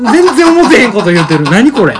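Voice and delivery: laughing through the words; cracking up while talking. Real, not acted. Laughing at own lie.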